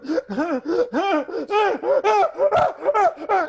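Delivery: a high, loud laugh that breaks out; loud burst of laughter. Real, not acted. Telling the host the jail story, a loud high burst of laughter.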